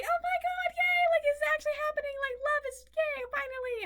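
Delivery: very high, fast, squealing joy; giddy excitement. Retold or imitated. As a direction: squeal like a kid who finally got it. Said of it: "and I'm like".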